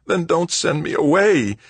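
high, distressed plea; pleading. A narrator's reading. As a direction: beg her to let you stay. Son begs not to be sent away.

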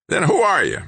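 high, raspy, sharp demand; sharp question. A narrator's reading. Old man interrogating the stranger, sharply. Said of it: "the old man asked sharply".

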